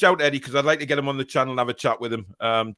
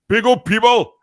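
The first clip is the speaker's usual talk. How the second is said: high, loud MC shout of big up; hyped shout; 11 semitones higher, 11 dB louder than usual. Shown as a demonstration. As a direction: shout big up like a hype man.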